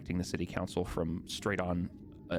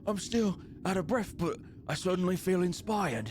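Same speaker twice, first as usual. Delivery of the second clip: winded guard; 9 semitones higher than usual. Acted. Winded guard responds to tune.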